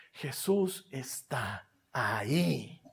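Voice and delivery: soft, low and slow; hushed. Real, not acted. Preacher revealing Jesus to the church, hushed and slow. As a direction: drop to an awed hush. Quiet awed climax line.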